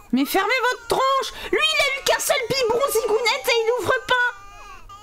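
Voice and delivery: high, fed-up scolding; exasperated yelling. Real, not acted. Frazzled player yelling at her crying Sims babies, high scolding. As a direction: shout down the whole crying nursery.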